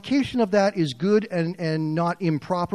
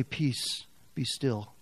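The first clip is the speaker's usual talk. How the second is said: softer, tender hush; gentle soothing; 4 semitones lower, 8 dB quieter, much slower than usual. Acted. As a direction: soothe a frightened listener. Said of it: "God wants to say".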